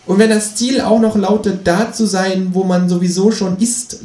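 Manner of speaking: high, pushy kangaroo voice; a cheeky high voice for the Kangaroo. A narrator's reading. Känguru nags the narrator to clean the bath, high and pushy.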